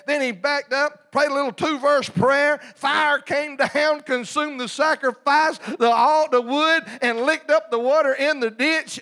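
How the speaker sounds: full-force preaching. Real, not acted. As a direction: preach it out to the whole church, loud. Preaching climax at full volume.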